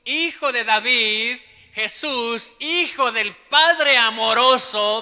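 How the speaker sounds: loud, slow desperate plea. Retold or imitated. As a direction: shout it like a blind beggar begging for mercy. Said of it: "Más gritaban". (grito desesperado y lento)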